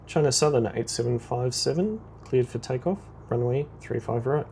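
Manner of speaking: usual speaking voice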